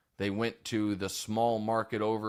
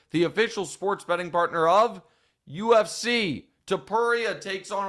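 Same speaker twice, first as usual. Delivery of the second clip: higher, punchy sportsbook promo; sponsor ad read; 4 semitones higher than usual. Real, not acted. Podcast host pitching UFC betting to listeners, promotional read. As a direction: sell the fight night with lifted pitch.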